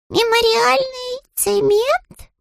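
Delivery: very high, slow rising cub voice; stunned little bear. A narrator's reading. Paddington baffled by the wet cement, high slow question. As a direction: repeat it baffled, slowly. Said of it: "ошарашенно повторил Паддингтон".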